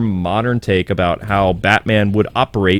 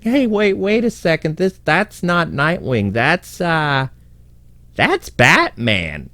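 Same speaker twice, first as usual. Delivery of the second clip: lightly voiced crook, high at first and dropping; a hint of a baffled thug slowly working it out; puzzled criminal; 7 semitones higher, slower than usual. Bloodhaven criminal looking at the fake Nightwing, dawning suspicion. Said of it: "If I was a Bloodhaven criminal".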